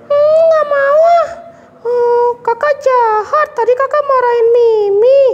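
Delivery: high, slow, mock crying sulk; sulking puppet girl. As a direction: pout and whimper like a hurt child. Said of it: "kok Mimi merajuk sih".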